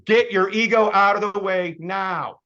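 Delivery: drill-sergeant push, slow and loud; loud slow command. Shown as a demonstration. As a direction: bark it at the room like a coach.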